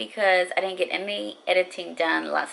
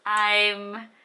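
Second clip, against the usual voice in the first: usual speaking voice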